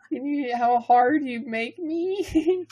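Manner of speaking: high, loud, very drawn-out squeal; absurd character voice. Pretend. Reader clowning for co-host, high slow voice. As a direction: stretch every word up high.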